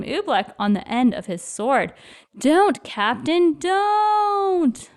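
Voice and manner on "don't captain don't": slow, high, rising cry of no; desperate plea. Acted. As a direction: beg him to stop before it's too late. Trying to stop the captain eating.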